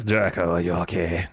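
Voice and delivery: low, put-on gothic voice. Shown as a demonstration. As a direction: drop deep into a mock-gothic voice. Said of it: "Is it this voice?".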